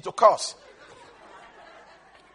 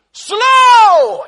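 a sudden high, loud cry of slow; shouted; 10 semitones higher, 10 dB louder than usual. Shown as a demonstration. Preacher to congregation, one shouted word. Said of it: "God is very slow".

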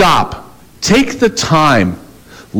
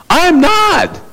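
indignant denial; 13 semitones higher, slower than usual. Retold or imitated. Retelling denial, voice jumps high.

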